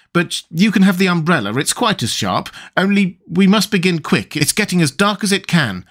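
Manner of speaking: brisk Tweedledum. A narrator's reading.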